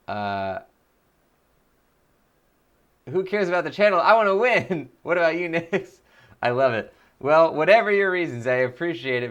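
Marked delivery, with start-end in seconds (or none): 3.1-4.9 mock whiny gamer. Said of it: "Peter was saying".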